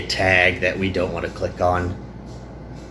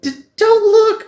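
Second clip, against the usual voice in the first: put-on much higher, stammering, slow girl's plea; flustered girl; 8 dB louder, slower than usual. Trapped girl begging her friend not to look, high and flustered. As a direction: squeak it out, embarrassed and pleading. Yuki voiced, trapped in slime.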